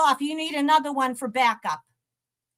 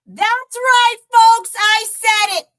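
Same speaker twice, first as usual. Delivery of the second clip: loud, higher, proud; loud defiant boast; 5 semitones higher, 9 dB louder, slower than usual. Real, not acted. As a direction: announce it to the whole audience, loud. Defiant announcement to viewers.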